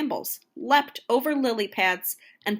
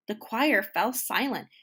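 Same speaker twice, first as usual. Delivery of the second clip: ordinary delivery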